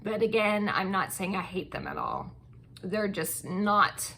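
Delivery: usual speaking voice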